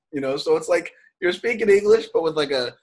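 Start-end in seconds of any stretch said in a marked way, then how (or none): none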